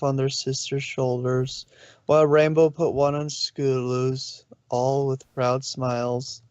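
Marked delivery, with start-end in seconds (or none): none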